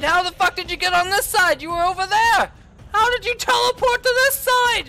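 loud exasperated yelling; real frustrated shouting. Real, not acted. Gamer shouting at Mister X for teleporting. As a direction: yell in disbelief.

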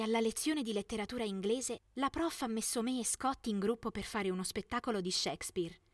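ordinary delivery, nothing marked

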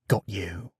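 soft, private aside; inward thought. A narrator's reading. Odysseus thinking to himself as the Cyclops drinks, quietly. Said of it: "thought Odysseus".

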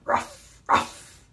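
short breathy barks; dog bark. Shown as a demonstration. Reader leading children in barking like this dog. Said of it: "and barking like this dog".

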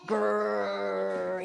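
low drawn-out growl; storybook bear growl. A narrator's reading. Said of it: "he growled".

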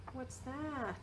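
ordinary delivery, nothing marked

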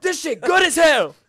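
very high, loud, slow laughing shout; mock kitchen crasher praise. Friend mimics the trend guy praising food, shouting while laughing. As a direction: bellow praise through laughter. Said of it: "He's like".

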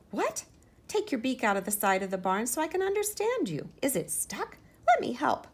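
high, loud squeaky mouse voice; little mouse. Acted. Little mouse offering Henrietta help, high and loud. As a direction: chirp it up like a tiny mouse.

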